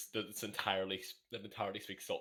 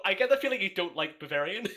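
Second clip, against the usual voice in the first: louder, higher teasing jab; 8 semitones higher, 7 dB louder than usual. Real, not acted. Teasing the friend who hates Bavarian, loud and mocking. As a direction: poke fun at his grudge, out loud.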